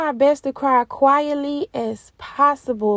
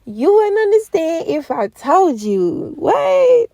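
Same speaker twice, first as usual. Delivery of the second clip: high, loud, laughing disbelief; overjoyed exclamation; 6 semitones higher, 6 dB louder than usual. Retold or imitated. Worker telling manager she could never understand, exuberant. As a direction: burst out higher and louder, amused and amazed.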